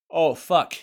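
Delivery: a loud, high yelp of panic; sudden alarm. Pretend. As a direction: jolt as if you just remembered. Remembers to vote while driving.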